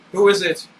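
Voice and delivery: high, loud cry with a crack; shouted, voice cracking. A narrator's reading. Dan shouting at the pounding door, voice cracking. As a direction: yell it through the door, voice breaking. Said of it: "he shouted, his voice cracking".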